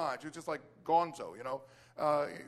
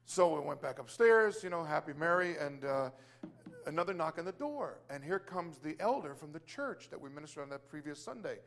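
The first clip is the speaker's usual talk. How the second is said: ordinary talk, nothing marked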